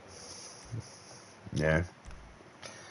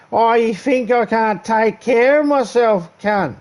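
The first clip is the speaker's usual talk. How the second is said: put-on much higher, louder, slow aggressive drunk; mock drunk snapping at a sober man's take care; belligerent drunk; 7 dB louder, slower than usual. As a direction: pick a fight out of nothing. Drunk turns belligerent suddenly.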